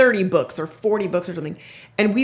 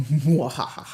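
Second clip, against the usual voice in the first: evil cackle; gloating villain laugh; mad scientist; 6 semitones lower than usual. Pretend. Gloating over free library books.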